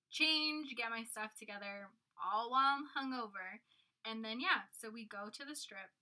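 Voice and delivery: ordinary talk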